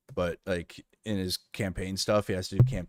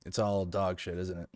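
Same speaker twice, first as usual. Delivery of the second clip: ordinary talk, nothing marked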